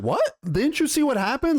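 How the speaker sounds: high-pitched disbelief, imitated; incredulous. Brother questions Cole's plan, mock incredulous.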